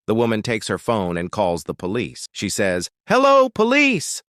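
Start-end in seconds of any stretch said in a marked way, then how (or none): 3.1-4.2 very high, loud, drawn-out call; urgent call for help. A narrator's reading. Wife phoning the police for help, urgent and high-pitched. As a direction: call out high and slow, raising volume.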